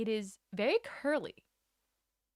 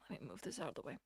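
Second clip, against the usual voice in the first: muttered aside; 6 semitones lower, 9 dB quieter, much faster than usual. Real, not acted. Aside while adjusting camera.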